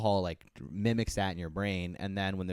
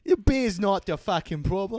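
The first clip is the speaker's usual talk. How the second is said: peasant voice; 11 semitones higher, 8 dB louder than usual. Acted. Villager voice.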